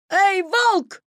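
high, loud call after someone; shouting. A narrator's reading. Petya calling after the running Wolf, high shout. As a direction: yell to catch his attention. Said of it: "закричал Петя".

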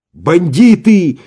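slow, loud high yell; shouting. A narrator's reading. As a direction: hurl the insult. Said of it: "прокричал Иван". (громкий крик)

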